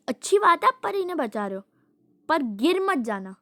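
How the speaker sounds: mom's warning. Retold or imitated. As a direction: warn them kindly like a mother. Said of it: "mummy कहती".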